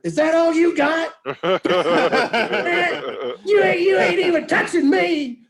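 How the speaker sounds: high, loud, defiant taunt; taunting yell. Retold or imitated. Hit pitcher yelling at the batter, loud taunt. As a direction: yell it out to goad them. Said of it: "I yell at the batter".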